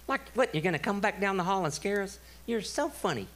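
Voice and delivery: put-on high, light little-girl voice; imitated daughter, unimpressed and high; daughter's teasing scoff. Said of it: "She went".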